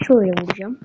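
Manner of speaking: ordinary talk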